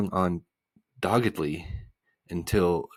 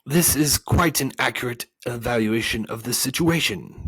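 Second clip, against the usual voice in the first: higher, louder, cold character voice; coldly; 5 semitones higher, 6 dB louder than usual. A narrator's reading. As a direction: pronounce it coldly. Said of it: "said the voice coldly".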